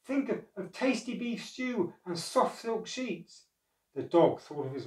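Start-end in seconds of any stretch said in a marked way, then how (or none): 0.0-3.4 high-pitched coaxing; eager, higher storybook cat voice; urging. A narrator's reading. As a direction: spur on a tired friend with treats. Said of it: "urged the cat".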